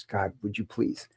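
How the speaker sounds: soft, slow plea; a patient quietly begging the anesthesiologist for relief; pleading. Retold or imitated. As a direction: beg quietly and drawn out. Begging for sedation.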